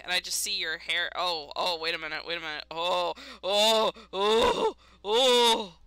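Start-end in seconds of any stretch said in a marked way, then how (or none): 1.1-5.7 rising frightened cries, louder; gasping oh, getting louder in fright; startled, building fear. Real, not acted. Startled by a jump scare.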